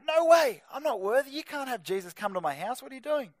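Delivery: high-pitched mock panic; panicky, high-pitched acted outburst; freaking out. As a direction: act out a flustered centurion protesting. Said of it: "the centurion starts freaking out".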